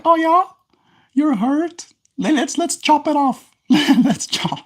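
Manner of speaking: very high falsetto mock-dad voice; joking dad. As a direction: tease in a silly, high-pitched dad act. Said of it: "do the, the dad thing".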